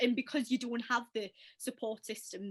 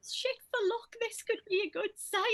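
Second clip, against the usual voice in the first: high, bright-sided mock cheer; cheery optimism; 10 semitones higher than usual. Friend spinning bird poo as good luck, high mock cheer. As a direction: pitch it way up, playing the optimist. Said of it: "I remember I was like".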